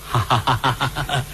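low, soft hearty chuckle; low put-on laugh; acted chuckle. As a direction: chuckle low as the genial master. Master laughs at keeper's greeting.

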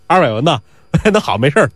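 plain reading voice

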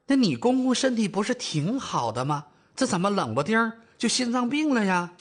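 high-pitched, puzzled mum; older mother, startled. Acted. Mother asks about in-law's sudden heart trouble, surprised. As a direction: question the news in mild disbelief. Mother reacts to bad news.

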